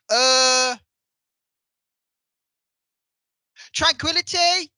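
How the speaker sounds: long loud "uh", then high questioning word; Bradley Walsh, dazed. Retold or imitated. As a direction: imitate a dazed actor groping for his line. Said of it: "as if he's dazed".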